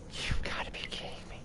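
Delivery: soft, breathy incredulous; stunned whisper; whispered disbelief. Real, not acted. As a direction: say it under your breath.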